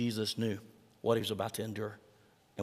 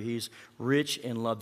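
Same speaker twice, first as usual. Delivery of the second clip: usual speaking voice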